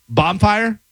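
"bonfire" said BOM-fire; mispronounced word. Shown as a demonstration. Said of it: "what about".

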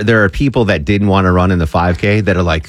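ordinary talk

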